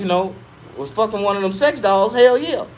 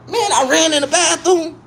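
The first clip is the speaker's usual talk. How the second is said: normal talking voice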